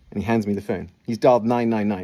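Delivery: ordinary delivery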